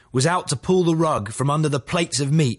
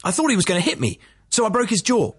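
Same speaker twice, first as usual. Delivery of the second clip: higher-pitched character quote, matter-of-fact; 8 semitones higher, faster than usual. A narrator's reading. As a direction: recount it as plain fact, pitched up a little. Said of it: "matter-of-factly".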